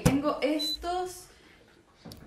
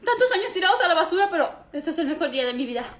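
ordinary talk, nothing marked

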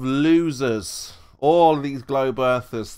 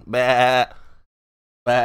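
imitated sheep bleating; 2 semitones lower than usual. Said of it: "Listen to this sheep bleating".

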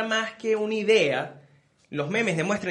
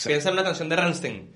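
ordinary delivery

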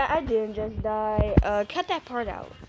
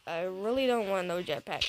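ordinary talk

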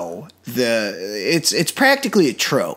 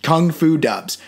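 ordinary delivery

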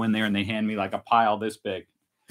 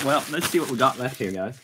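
ordinary talk

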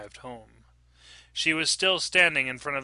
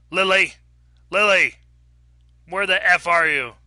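loud, raised-pitch bellow; angry shout; 6 semitones higher, 10 dB louder than usual. Acted. Drunk husband bursting in for his wife, shouting. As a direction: yell for her through the house.

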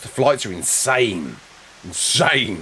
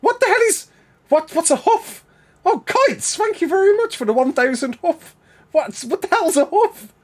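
high, incredulous questions; can't believe what just came in; a real surprise that stays high; baffled surprise. Real, not acted. Baffled by a strange donation.

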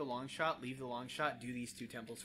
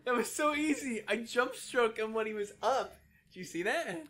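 gloating; 10 semitones higher, 8 dB louder than usual. Real, not acted. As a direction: crow about the win. Gloating over easy kill.

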